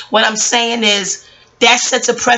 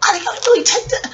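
high, fast disbelief; can't believe what she's hearing; retelling her own shocked reaction, high and fast; incredulous; 11 semitones higher, faster than usual. Retold or imitated. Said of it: "'Cause I'm like".

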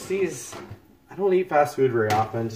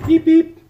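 high, loud beeping; a sharp mock beep-beep; mock timer beep; 9 dB louder than usual. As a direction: chirp it like a kitchen timer.

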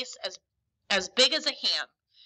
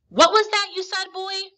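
high, loud startled question; Silver questioning; 10 semitones higher, 8 dB louder than usual. A narrator's reading. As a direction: pitch it up and demand a repeat. Silver sharply questions the boy.

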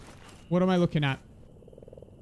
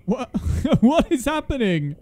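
laughing in disbelief; high, loud, laughing question; 5 semitones higher, 5 dB louder than usual. Real, not acted. Laughing at sudden chaos.

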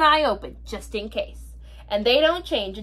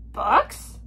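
confused pirate; 2 semitones lower than usual. Acted. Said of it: "Braidbeard looked confused".

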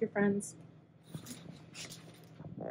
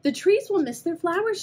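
sadly; 11 semitones higher, 5 dB louder than usual. Acted. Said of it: "she said sadly".

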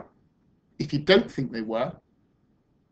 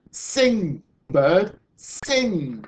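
raised, insistent coaxing; loud storybook incantation, higher pitched; urging command; 5 semitones higher, 5 dB louder, much slower than usual. Acted. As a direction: command it to sing, each word separate. Sue commands the bird to sing.

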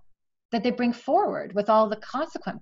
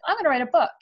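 higher, louder, bright burst of determination; eager resolve; 7 semitones higher, 7 dB louder, faster than usual. Retold or imitated. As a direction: announce it like a sudden decision. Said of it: "I was like".

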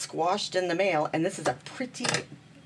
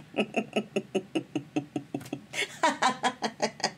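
amused laugh; 4 semitones higher than usual. Real, not acted. Laughs at forgotten recording.

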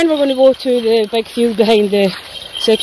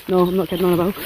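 normal talking voice

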